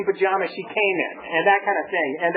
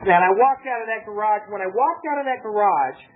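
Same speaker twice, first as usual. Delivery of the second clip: usual speaking voice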